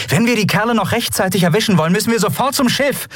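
high, fast, urgent woman's voice; urgent young captain. Acted. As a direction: press the crew to hurry, rushing. Endriel voiced by narrator.

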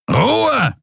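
high, acted shout for silence; bellowing. A narrator's reading. Guards silence the prisoners, high bellowed order. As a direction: yell to cut off the chatter. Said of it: "brüllte man sie an".

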